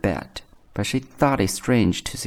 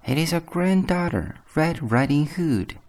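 put-on much higher, girlish voice; wolf faking a child's high voice; imitated little girl; 9 semitones higher than usual. A narrator's reading. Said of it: "speaking like the little girl as well as he could".

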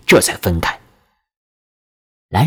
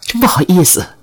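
apologetic; 8 semitones higher than usual. A narrator's reading. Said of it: "我陪笑道".

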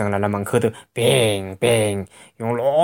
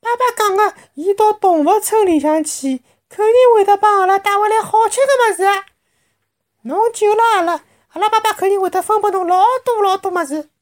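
put-on very high, childlike chirping; little cub voice; 4 dB louder than usual. A narrator's reading. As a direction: speak like a tiny eager animal child. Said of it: "有的一只小物事还讲".

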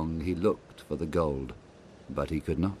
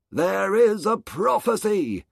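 higher, louder announcing; solemn crowd; 13 semitones higher, 5 dB louder than usual. Acted. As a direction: proclaim the prophecy. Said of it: "said the people".

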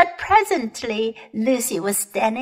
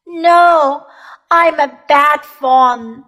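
loud, mournful self-reproach; between sobs; 5 semitones higher, 7 dB louder than usual. A narrator's reading. The faun blames himself before Lucy, tearfully. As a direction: lament it loudly, as if tearful. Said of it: "between his sobs".

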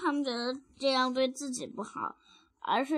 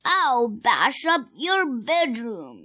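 loud, slow, drawn-out threat; shouted threat; 5 dB louder, much slower than usual. Acted. Child rereading a twin's threat, shouting slowly. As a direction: belt out each word slowly.

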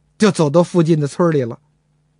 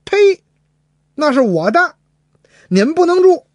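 angry retort; 10 semitones higher, 3 dB louder than usual. Acted.

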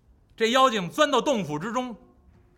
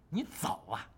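quiet sly question; 6 semitones lower, 13 dB quieter than usual. Acted. Wukong quietly stops Pig.

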